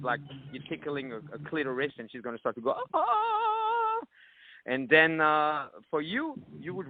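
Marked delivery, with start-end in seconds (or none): 2.9-4.0 mock female moan. Mimicking woman's moan.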